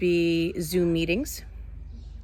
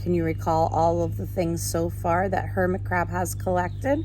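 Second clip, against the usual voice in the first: lively, sing-song storybook reading, higher than her chat; reading to children; 5 semitones higher than usual. Acted. Teacher reading a picture book to her class, animated storytelling. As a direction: read it like a picture book for little ones.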